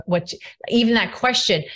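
normal talking voice

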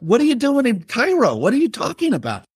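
high, startled questions; can't believe where his brother is; incredulous. Retold or imitated. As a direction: brother calling from Cairo after a bomb, high disbelief. Brother calls from a bombing.

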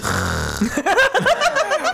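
put-on shrill child scream; high scream sound effect.

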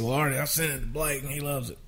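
lightly voiced producer, quick delivery; imitating Craig Wiseman. Songwriter imitates co-writer breaking the news, quick, lightly put-on voice. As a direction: rattle it off in the co-writer's voice. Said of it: "I'm doing a Craig Wise imitation".

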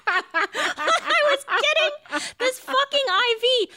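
high, slow, wobbling through laughter; half sob, half laugh while retelling; laugh-crying. Retold or imitated. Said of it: "I'm, like, sobbing".

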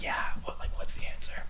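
soft whisper, sneaky. Retold or imitated. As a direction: whisper it like cheating in class. Classmate asks in quiz.

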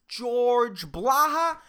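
usual speaking voice

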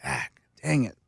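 low, soft disappointed groan; quiet groan of dismay. Retold or imitated. Fan groaning at a Dak interception, quiet and low. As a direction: sigh it out, hands on head. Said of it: "put my hands over my head and go".

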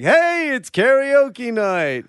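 high, drawn-out announcement; stretched-out emcee call; karaoke announcer. Retold or imitated. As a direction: call out to the room like a karaoke host. Voices the karaoke night announcement.

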